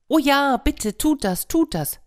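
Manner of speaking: high, eager fake pleading; pleading hare. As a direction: beg for it, slyly. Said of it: "sagte der Hase".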